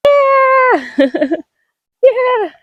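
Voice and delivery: very high happy yell with a laugh; excited cheer. Real, not acted. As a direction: celebrate with a squealing yeah. Cheering about the hiking trail.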